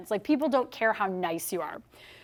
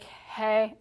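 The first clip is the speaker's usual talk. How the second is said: a limp, soft, bored greeting; uninterested doorstep hey; disinterested; 3 dB quieter than usual. Shown as a demonstration. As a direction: greet them like you couldn't care less. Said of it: "I'm disinterested".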